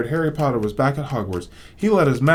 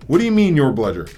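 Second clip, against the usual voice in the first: louder, indignant accusation; rising anger; 5 semitones higher, 4 dB louder than usual. Acted. Boy accusing the elf of the rogue bludger, angry. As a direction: accuse him outright. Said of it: "anger rising once more".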